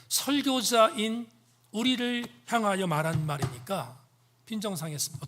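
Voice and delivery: normal talking voice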